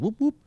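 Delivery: high-pitched mock police siren whoops; imitated siren whoop. Storyteller tells friends cop cars pulled up, imitated siren.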